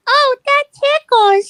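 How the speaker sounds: very high, slow giggly puppet; tickled furry puppet voice; Elmo voice. Pretend.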